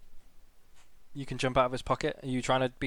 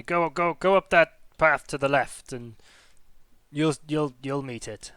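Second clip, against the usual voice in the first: chuckling kobold; 7 dB louder than usual. Acted. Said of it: "you just kinda hear chuckling".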